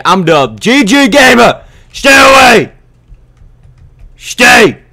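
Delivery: high, very loud shout; shouted warning. Real, not acted. Gamer warning off a rival player, shouting. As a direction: yell them back, pitch way up. Warns off an approaching opponent.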